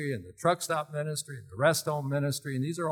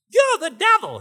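much higher, whiny and loud; shrill mocking kid voice; 10 dB louder than usual. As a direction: whine it high like a taunting kid. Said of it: "He had this real high, whiny type voice".

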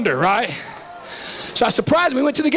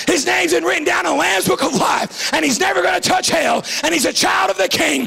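high, shouted revival climax; full-throated, rhythmic rallying cry; fiery preaching; 6 semitones higher than usual. Real, not acted. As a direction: whip the congregation up, phrase after driving phrase. Preaches at full force to congregation.

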